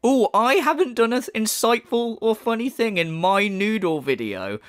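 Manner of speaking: mock-wounded opening that drops at the end; sarcastic echo. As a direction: parrot the criticism back sneeringly. Sarcastically throws back criticism.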